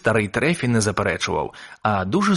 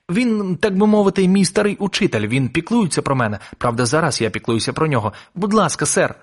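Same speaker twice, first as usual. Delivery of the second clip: a hint of an earnest boy, higher at the start; lightly voiced pleading boy; 7 semitones higher than usual. A narrator's reading. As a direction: explain and plead a little, as a boy.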